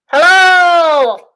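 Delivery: high, loud hello through a megaphone. Shown as a demonstration. Teacher showing the kids her paper megaphone. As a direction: call out through the cup megaphone. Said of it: "So now I have a megaphone".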